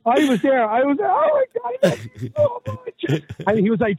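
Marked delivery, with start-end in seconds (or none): 1.0-3.2 high, overjoyed sob of love; hammed-up tearful gushing; mock gushing. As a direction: gush at him way up high like a blubbering dad. Dad gushing at son in toy shop.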